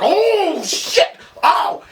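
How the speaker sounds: high, loud hyped yell; excited yell. Retold or imitated. Fan watching Joffrey choke, high excited yell. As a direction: yell it like a dunk just happened. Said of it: "It was like".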